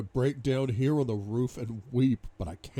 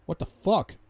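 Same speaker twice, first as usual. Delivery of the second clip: higher, louder burst of disbelief; startled curse, pitched up; startled outburst; 8 semitones higher, 5 dB louder than usual. A narrator's reading. Man reacts to a call from Welch, startled.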